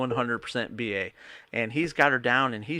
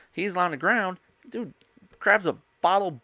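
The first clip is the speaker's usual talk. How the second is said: ordinary talk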